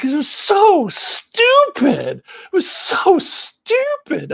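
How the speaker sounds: high, drawn-out mock disdain; imitating Australian girls. Imitating Australian exchange students on a comedy film, high drawn-out mockery. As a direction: stretch it out slow and high, mocking the visitors. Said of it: "They were like".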